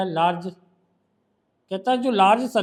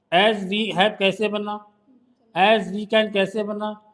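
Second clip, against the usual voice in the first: slow quiz; much slower than usual. Shown as a demonstration. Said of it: "slowly quizzes the phrases".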